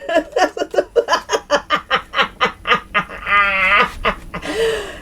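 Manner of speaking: high, long real laugh; helpless laughter. Real, not acted. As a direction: crack up uncontrollably. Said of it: "I'm dying laughing".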